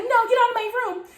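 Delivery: high-pitched mimicry; flustered accuser. Accuser orders teller out of her room, high and flustered. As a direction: imitate a protesting order, pitch up.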